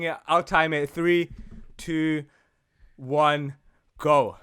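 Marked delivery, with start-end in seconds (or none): none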